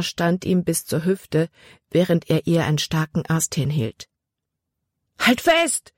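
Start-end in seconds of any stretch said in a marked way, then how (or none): 5.2-5.9 a high urgent call, rising at the end; boy calls to the girl in the flood; shouted order. A narrator's reading. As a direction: yell to her to hold on. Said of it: "rief er".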